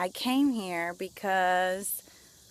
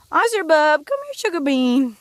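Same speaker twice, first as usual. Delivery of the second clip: loud, higher sweet call to the dog; 9 semitones higher, 11 dB louder than usual. Real, not acted. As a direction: coax your dog over with pet names. Calling the dog over.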